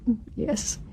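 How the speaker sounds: very soft, tearful little yes; crying widow. Acted. Actress replays her funeral scene, softly tearful. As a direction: barely get it out through mock tears. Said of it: "and she's crying".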